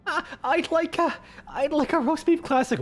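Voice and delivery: mock-meek customer, unsure and halting; timid customer. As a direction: order nervously in a small high voice. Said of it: "they're just like".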